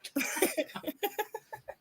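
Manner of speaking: high-pitched delighted laugh. Real, not acted. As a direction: burst into a squeaky laugh. Laughing at own impression.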